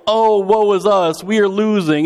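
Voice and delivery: hammed-up self-pity; mock lament. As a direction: wail it mockingly, stretched out. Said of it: "So it's not this like".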